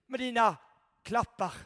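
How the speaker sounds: ordinary talk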